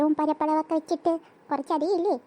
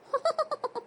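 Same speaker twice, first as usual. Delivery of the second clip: high, soft mocking giggle; mocking laugh; 10 semitones higher, 4 dB quieter than usual. Real, not acted. Sister laughing at the dance practice.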